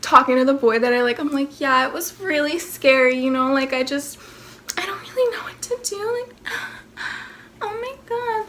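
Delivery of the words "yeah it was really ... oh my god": soft, high fake-scared little voice; acting innocent and cute. Talking to the boy she likes, fake scared and cute. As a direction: play the fragile victim to charm him. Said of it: "trying to act all innocent and cute".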